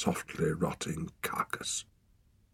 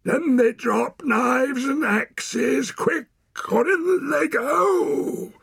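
high, loud, slow ultimatum; shouted threat; 6 dB louder, much slower than usual. A narrator's reading. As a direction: shout the demand as a menacing threat. Wild man threatens to drown weapons.

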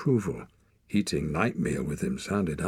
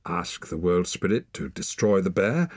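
ordinary delivery, nothing marked

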